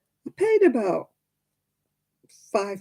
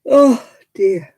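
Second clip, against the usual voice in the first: mock crying; 5 semitones higher, 11 dB louder than usual. Real, not acted. Mock despair at swimsuit.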